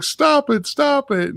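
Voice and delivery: very high mock-panicked plea; freaking out. Man to the ghosts, high freaked-out squeal. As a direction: squeal it in comic distress. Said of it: "so he's freaking out".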